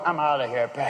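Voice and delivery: low dog voice; fed-up dog. Retold or imitated. Dog quitting the family, low fed-up voice. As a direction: say it low, done with this house. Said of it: "the dog's going".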